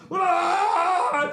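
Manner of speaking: high mock victory scream; a short high yell at the crowd; imitated scream. Steph Curry mimicked screaming at the crowd. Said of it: "looks at the crowd and goes".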